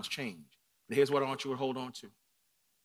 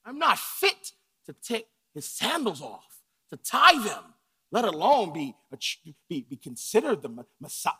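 loud, higher, slowed insistent denial; emphatic refusal; 6 semitones higher, 11 dB louder than usual. Retold or imitated. Servant loudly refusing praise that belongs to Christ. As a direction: refuse it with full force. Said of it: "you've got to say".

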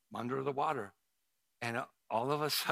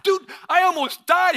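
much higher and louder, desperate; exhausted outburst; 16 dB louder than usual. Retold or imitated. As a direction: blurt it out, barely alive. Retold himself reaching shore, exhausted.

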